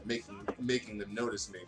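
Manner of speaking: ordinary talk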